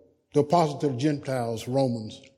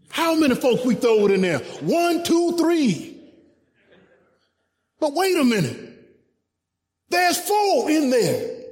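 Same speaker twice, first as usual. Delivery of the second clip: startled king; 12 semitones higher, 5 dB louder than usual. Retold or imitated. Startled king counting the furnace.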